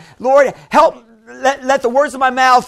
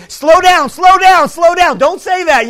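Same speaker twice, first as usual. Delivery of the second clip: loud, higher-pitched urgent warning; 6 semitones higher, 6 dB louder than usual. Acted. Holy Spirit trying to stop a hasty speaker, urgent warning. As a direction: call out to stop someone, voice raised. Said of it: "When the Holy Spirit's trying to say".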